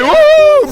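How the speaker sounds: high, loud "woo"; cheering football-fan whoop; excited whoop. Real, not acted. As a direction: whoop for your team. Cheering for City in banter.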